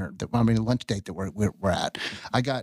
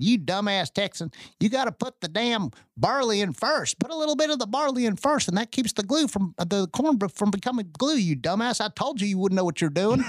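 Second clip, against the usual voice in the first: pitched way up, teasing exasperated rant; mock scolding mentor; 11 semitones higher than usual. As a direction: play the gruff old distiller chewing out his student, high and mocking. Mentor scolding on late phone call.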